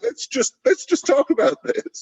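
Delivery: high, placating "whoa, hang on"; mock calming voice. Jesus calming the angry accusers, high placating voice. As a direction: soothe a furious crowd, hands up.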